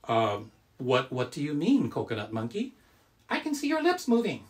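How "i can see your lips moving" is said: high squeaky puppet voice; squeaky ventriloquist dummy. Pretend.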